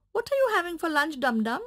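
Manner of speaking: hungry puppy asking the donkey; eager puppy voice. Acted. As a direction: rattle it off like an eager pup.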